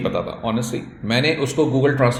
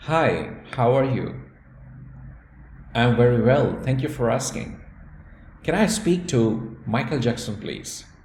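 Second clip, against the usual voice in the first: polite call opening; much slower than usual. Shown as a demonstration. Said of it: "پھر سے ایک دفعہ میں کرتا ہوں کوشش".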